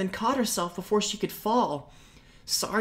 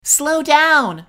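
much higher and louder call; 10 semitones higher, 12 dB louder than usual. Acted. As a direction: call after him from behind. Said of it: "Saria called".

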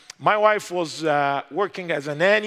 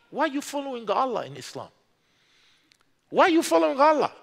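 challenging question; 7 semitones higher, faster than usual. Retold or imitated. Challenging the attorney general.

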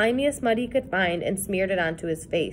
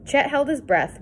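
plain reading voice

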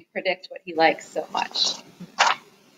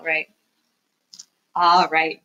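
ordinary delivery

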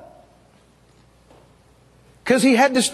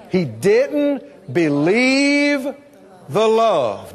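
drawn-out, slow believe; slow emphatic line; much slower than usual. Shown as a demonstration. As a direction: stretch out each word slowly. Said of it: "Say it out loud".

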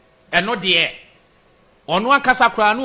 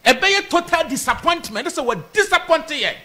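indignant warning; 6 semitones higher, slower than usual. Real, not acted. Supporter warning of total disappointment, high and indignant. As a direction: insist on it at raised pitch.

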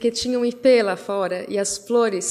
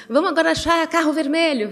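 cheerful game offer; 6 semitones higher than usual. Retold or imitated. Mother proposing car game.

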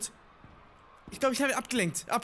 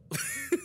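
squealing laugh; 3 dB quieter than usual. Real, not acted.

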